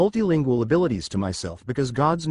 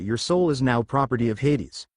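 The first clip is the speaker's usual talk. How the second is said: ordinary delivery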